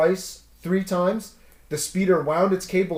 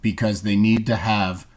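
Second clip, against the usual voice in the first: lower joke voice; 6 semitones lower than usual. Acted. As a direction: lean into the setup.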